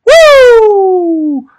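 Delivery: high, loud trademark Flair woo shouted out; Ric Flair woo. Retold or imitated. Vlogger hails Ric Flair with his signature woo, loud and high. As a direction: belt out the woo like the Nature Boy. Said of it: "Ric Flair will always be the man".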